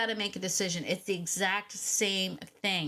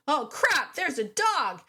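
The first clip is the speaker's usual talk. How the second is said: higher, louder panicked yelp; panicked owner; 8 semitones higher, 5 dB louder, slower than usual. Retold or imitated. Nervous owner sees an off-leash dog, panicked high cry. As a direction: blurt it out in sudden alarm.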